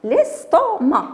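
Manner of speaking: high, slow "estomac" ending on a; silent final C. Shown as a demonstration. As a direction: leave the C of estomac unsounded. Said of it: "C ne se prononce pas". (estomac lentement, C final muet)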